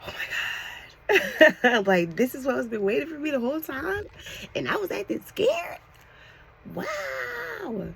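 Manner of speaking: high-pitched, giddy amazement with laughter; overjoyed, squealing high; excited rush. Real, not acted. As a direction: gush with excitement, voice soaring. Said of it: "I felt so excited".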